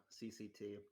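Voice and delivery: normal talking voice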